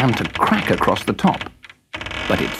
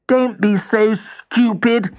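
much higher, slow scornful toy voice; scornful Jack; 11 semitones higher, 3 dB louder, slower than usual. Acted. Jack scoffing at the lion, high and slow. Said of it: "said Jack in the Box".

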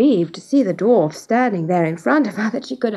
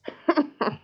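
higher, softer dwarf cackle; cruel laugh; 5 semitones higher, 7 dB quieter than usual. A narrator's reading. Dwarf gloats over his price, cruel cackle. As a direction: cackle cruelly in quick bursts. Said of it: "with a cruel laugh".

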